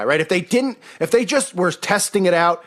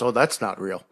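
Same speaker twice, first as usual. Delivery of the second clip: low, soft scoffing dismissal; dismissive mockery; 6 semitones lower, 4 dB quieter than usual. Crowd dismisses demon movies, low soft scoff. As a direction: brush it off like a sceptic. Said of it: "everybody goes".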